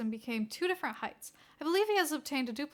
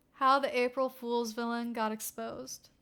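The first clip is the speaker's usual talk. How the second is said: ordinary delivery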